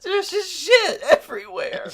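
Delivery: high squeaky laugh through the words. Real, not acted. Laughing while repeating his friend's line. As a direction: crack up while echoing the line.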